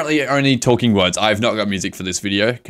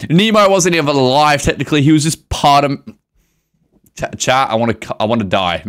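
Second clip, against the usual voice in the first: usual speaking voice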